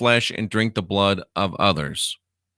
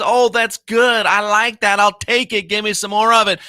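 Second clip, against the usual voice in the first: mock satisfied believer; 11 semitones higher, 4 dB louder than usual. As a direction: parody someone savouring the yoke. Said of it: "What kind of an intelligent person says".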